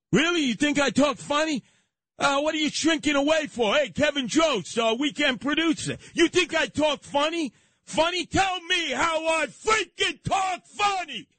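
high, escalating indignant challenge; hammed-up unhinged fury, slowing on the last demand; mock psycho outrage. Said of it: "see the psycho side of me".

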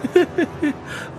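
nervous laugh. A narrator's reading. Trapped Fortunato laughs.

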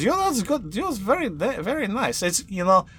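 a playful high NPC voice; French-synth NPC voice. Acted.

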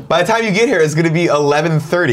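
high, exasperated voice; exasperated retelling. Retold or imitated. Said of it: "I'm like".

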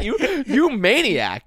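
slow, high amused scolding; laughing disbelief. Retold or imitated. Friend scolds the driver slowly, high and amused. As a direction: stretch it out, laughing at him. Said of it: "I'm like".

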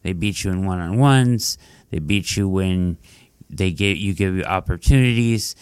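normal talking voice

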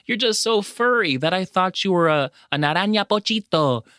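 put-on high, sugary baby talk to a pet; cutesy animal voice. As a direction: coo at the guinea pig, high and sweet. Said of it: "in a cutesy voice that gives me chills".

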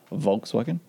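Volkswagen with a German V for the W. Shown as a demonstration. Host joking with German W words, W as V. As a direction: softly test Volkswagen, W turned to V. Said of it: "pronounce words with V's".

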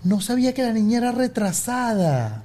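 high-pitched imitation of the lady; tactless lady. Lady remarking on the daughter, imitated in high voice. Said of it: "Pero me lo dijo así".

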